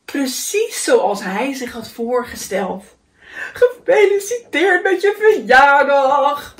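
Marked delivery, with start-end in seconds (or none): 3.5-6.6 loud, high, slow congratulation; hearty. A narrator's reading. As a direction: congratulate with a big drawn-out flourish. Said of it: "zei Haas hartelijk". (hartelijk en uitbundig feliciterend)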